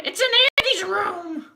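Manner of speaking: very high, slow, squeaky toy-lady voice; shrill cartoon Mrs. Potato Head. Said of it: "such a perfect, uh, Missus Potato Head".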